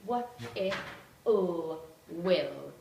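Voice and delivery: letter sounds one by one, then the word; phonics-style segmenting with gaps between sounds; sounding out. Shown as a demonstration. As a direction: sound it out slowly, piece by piece, then blend. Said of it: "to sound out as they read".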